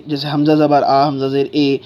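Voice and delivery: "حمزہ زیر اے" ending on ay; brisk recital of "حمزہ زیر اے" with the Urdu ay vowel; wrong vowel demo. Shown as a demonstration. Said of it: "اے نہیں پڑھنا ہے اس کو".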